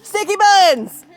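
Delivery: slow, high, loud drawn-out cry; excited call. Real, not acted. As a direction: call out the nickname with delight. Excited nickname call on hike.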